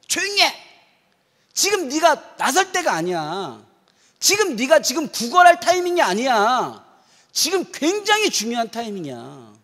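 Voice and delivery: loud, high scolding; crowd scolding. Retold or imitated. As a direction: bystanders loudly silencing the beggar. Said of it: "사람들이 이 거지에게 뭐라고 했냐면".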